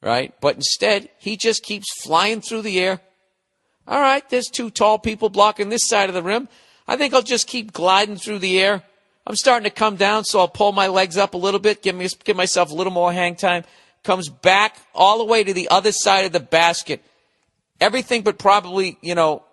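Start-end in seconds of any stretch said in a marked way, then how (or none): 3.9-13.6 lightly voiced player thinking mid-air, a bit higher; player's inner voice. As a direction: muse calmly while hanging in the air. Voicing the player's thoughts mid-air.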